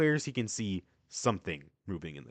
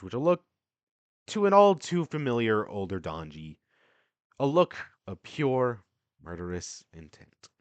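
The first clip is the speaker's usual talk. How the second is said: normal talking voice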